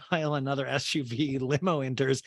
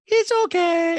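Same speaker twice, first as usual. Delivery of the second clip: very high, loud falsetto squawk; hammed-up piercing falsetto; Diana impression; 10 dB louder, slower than usual. Diana reassures a masked guest, in mock loud falsetto. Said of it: "And Diana's like".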